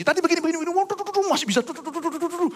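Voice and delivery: high, fast mimic of a girl briskly explaining to police; high-pitched playful imitation of nonstop talking; mock rapid chatter. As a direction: rattle it off like a busy daughter. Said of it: "masih dia ngomong sama polisi".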